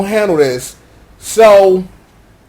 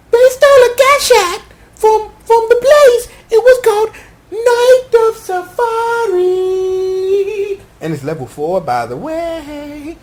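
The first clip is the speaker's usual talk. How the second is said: put-on high, louder squeaky girl; high girly voice; 11 semitones higher, 3 dB louder than usual. Poppy spilling news about the Gashato, high and squeaky. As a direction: pipe it up like an excited girl. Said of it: "Poppy like".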